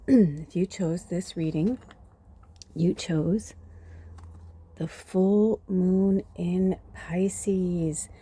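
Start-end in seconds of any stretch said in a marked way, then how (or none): 4.8-8.1 whisper. Real, not acted. Whispered card name.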